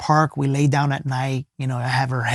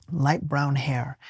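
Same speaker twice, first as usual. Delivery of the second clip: slow, much softer, lingering repetition; soft, awed echo; 7 dB quieter, slower than usual. Real, not acted. Recalling his vision of her, slow and awed. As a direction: repeat it slowly, savouring it.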